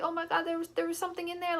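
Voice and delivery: high-pitched imitation of crying fright; retold tearful panic. Kid crying to dad about something in there, mock tearful. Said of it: "I'm crying".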